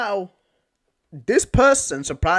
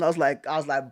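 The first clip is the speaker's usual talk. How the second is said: ordinary delivery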